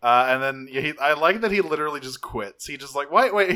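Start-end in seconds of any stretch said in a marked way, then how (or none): 3.1-3.5 high, loud startled wait; sudden realization. Retold or imitated. Bumblebee catching himself halfway through the punishment, a startled high wait. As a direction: snap out of it, higher and louder.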